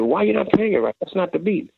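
high, pushing demand, mildly imitated; exasperated producer. Producer to young drummer, exasperated question. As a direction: challenge him like a frustrated boss.

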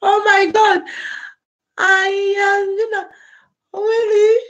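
choked up, voice rising high and breaking; overcome, fighting back tears; tearful. Real, not acted. Streamer overwhelmed by viewers' support, tearful and high.

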